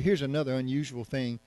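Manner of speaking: ordinary delivery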